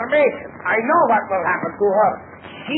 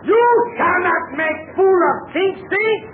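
indignant; 8 semitones higher than usual. Acted. Angry bandit chief scolds captive.

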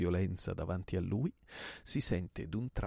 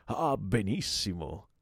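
delighted approval; 3 semitones higher, 6 dB louder, slower than usual. Acted. Host delighted at the offer.

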